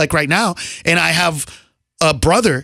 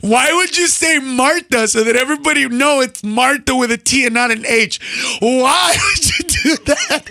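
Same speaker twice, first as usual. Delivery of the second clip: higher, mock-wailing sister voice; hurt sister; 5 semitones higher than usual. Sister upset at being named, mock hurt wail. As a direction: build the put-on complaint to a high wail. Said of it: "call me up all hurt, butt hurt".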